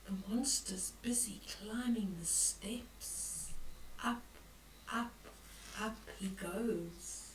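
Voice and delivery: creeping suspense. A narrator's reading. Granny building suspense as the monster climbs, whispered. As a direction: tiptoe the words up the stairs.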